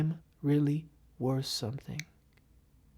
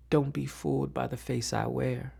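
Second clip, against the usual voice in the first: ordinary talk, nothing marked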